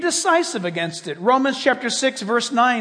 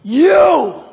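accusing shout; 7 semitones higher, 10 dB louder than usual. Acted. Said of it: "his voice says to you".

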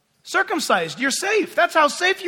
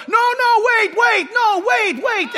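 high, loud, repeated objection; frantic protest; 10 semitones higher, 5 dB louder, slower than usual. Retold or imitated. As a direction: imitate his frantic stopping. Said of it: "the guy's going".

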